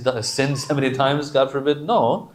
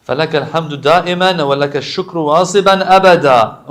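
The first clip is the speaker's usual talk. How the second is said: loud, quick burst of praise; Arabic supplication recital; 3 semitones higher, 9 dB louder, faster than usual. Acted. Praise belongs to God, loud devotional recitation. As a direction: recite it briskly at full voice.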